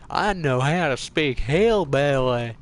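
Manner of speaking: a light mocking hillbilly voice, slowed. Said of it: "That'd be like saying hillbillies".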